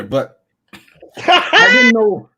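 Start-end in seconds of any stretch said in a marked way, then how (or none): 1.2-2.3 laughing. Real, not acted. Laughs mid-sentence at video.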